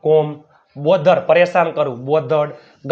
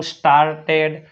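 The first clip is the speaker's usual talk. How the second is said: ordinary talk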